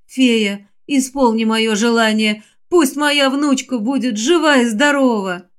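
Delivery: plain reading voice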